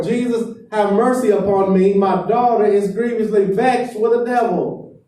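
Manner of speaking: loud, high desperate cry for mercy. Retold or imitated. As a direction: shout the plea, pitched well up. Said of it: "she yells out even more".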